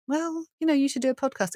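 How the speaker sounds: high, lilting mock suggestion; light sing-song prompting; voiced nagging thought. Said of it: "kept coming back to me".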